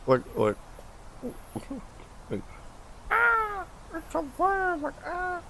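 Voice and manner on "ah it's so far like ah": high falsetto mock panic, fading softer; hammed-up scared squeak; mimicking a scream. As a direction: squeal then trail off. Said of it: "I was just, like, mimicking".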